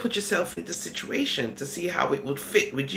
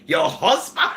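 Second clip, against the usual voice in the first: loud rising mock disbelief; stunned question, pushed louder; incredulous onlooker; 5 semitones lower, 5 dB louder than usual. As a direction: echo it back, acting shocked. Acted disbelieving onlooker questions her marriage.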